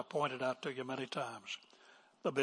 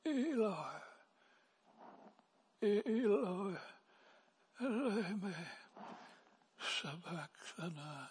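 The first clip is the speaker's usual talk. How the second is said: high, thin, faint wail fading softer; weak, barely managed cry; 5 semitones higher, 6 dB quieter, slower than usual. Acted. Preacher imagining himself on the cross, feeble high moan. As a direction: wail it feebly, as if hardly able to speak. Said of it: "I would probably have said, if I could say anything".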